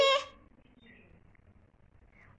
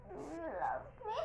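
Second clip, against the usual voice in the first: mock crying; 3 semitones higher, 7 dB quieter than usual. Fake crying question.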